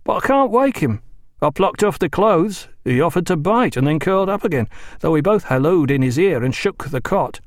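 a mate at his wits' end. Acted. Wilkins explains can't wake him.

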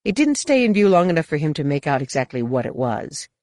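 ordinary delivery

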